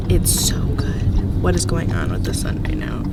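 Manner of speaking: breathy hushed aside; whispering. Real, not acted. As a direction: whisper it to yourself.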